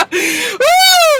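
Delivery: high shouted whoop of laughter. Real, not acted. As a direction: let out a wild whoop. Host laughing hard.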